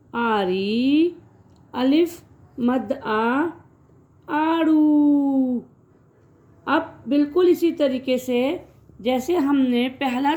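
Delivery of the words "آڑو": "آڑو" with a long stretched آ; long drawn آ in "آڑو", teacher demo. Shown as a demonstration. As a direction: hold the آ of "آڑو" slowly. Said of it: "اس کی آواز تھوڑی کھچ کے آتی ہے".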